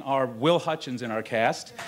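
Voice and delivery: ordinary talk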